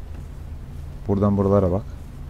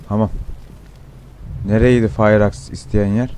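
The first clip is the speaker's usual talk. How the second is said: normal talking voice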